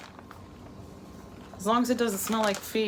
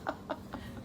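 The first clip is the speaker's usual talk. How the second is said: laughing; 6 semitones higher than usual. Retold or imitated. Quoting husband through laughter.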